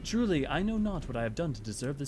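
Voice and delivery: formal, measured character voice with a lilt; earnest, stately gratitude; gracious noble knight. Acted. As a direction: greet an honored guest as a courtly commander.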